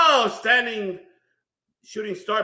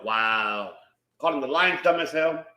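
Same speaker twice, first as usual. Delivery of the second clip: ordinary talk, nothing marked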